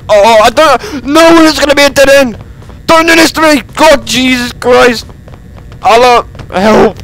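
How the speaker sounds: high, loud desperate pleading; frantic pleading. Real, not acted. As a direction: beg it, terrified. Trapped, praying for help.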